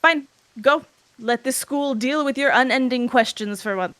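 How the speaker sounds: loud, sharp, high-pitched "Fine, go"; bitter dismissal. Acted. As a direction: bitterly send the son away. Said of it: "the bitter words".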